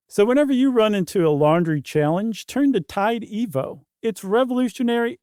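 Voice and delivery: bright announcer pitch for laundry soap; ad read. Acted. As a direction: sell it like a radio ad. Host reads sponsor ad.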